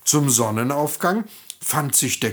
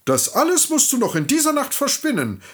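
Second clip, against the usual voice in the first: king's orders, much higher and faster; commanding king; 14 semitones higher, 3 dB louder, faster than usual. Acted. The greedy king orders the girl to spin all the straw, fast and high.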